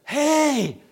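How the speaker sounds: high, loud holler; hollered call. Retold or imitated. Hollering to a man walking on lava, loud call. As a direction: holler to get someone's attention. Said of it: "hollered out".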